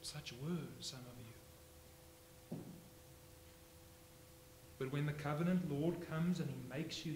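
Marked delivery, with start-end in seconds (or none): 0.0-2.6 very slow, much softer repeat; soft, slow echo. Acted. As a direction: let "were" fall quietly and linger. Repeated softly after shouting.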